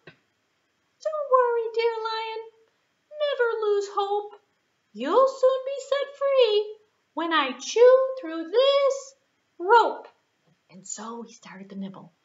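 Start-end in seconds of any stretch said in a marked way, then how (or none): 0.1-10.1 very high, slow, comforting squeak; reassuring mouse. Acted. Mouse calming the trapped lion, very high and gentle. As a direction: soothe the big lion.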